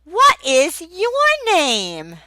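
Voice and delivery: slow, high, exaggerated teacher question; teacher to young child. Shown as a demonstration. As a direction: ask it like to a five-year-old. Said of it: "Like this".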